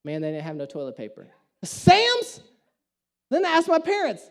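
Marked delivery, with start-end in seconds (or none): 0.0-1.3 low deadpan report. Retold or imitated. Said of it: "Comes back". 1.6-2.4 high, sharp disbelief; Sam's? thrown up in shock; incredulous. Real, not acted. Preacher stunned Sam's had none, incredulous.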